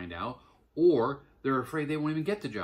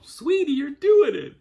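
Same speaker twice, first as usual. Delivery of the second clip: high, loud excited yell across the yard; dad cheering his daughter on from behind, yelling; 13 dB louder, slower than usual. Retold or imitated. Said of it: "the first one is I yell".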